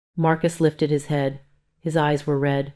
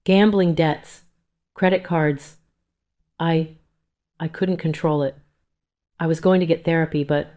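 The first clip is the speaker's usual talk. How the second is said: usual speaking voice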